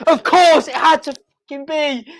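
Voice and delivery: high-pitched cry, still worked up; elated exclamation. Real, not acted. Fan reacting to Charlie Austin's goal, elated. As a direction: exclaim it high, fresh from screaming.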